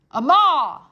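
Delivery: put-on loud shout to a deaf girl. Soldier shouting so deaf Mig can hear. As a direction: call it out loud and clear. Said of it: "shouted the soldier".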